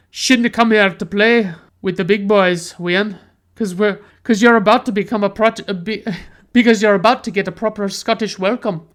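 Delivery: rough, mumbled Scottish tough guy; broad Scottish accent. Retold or imitated. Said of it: "this guy with this super thick Scottish accent says".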